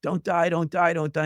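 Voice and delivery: a hint of a friend begging through tears; retold tearful plea. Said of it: "a gushing of tears and screaming".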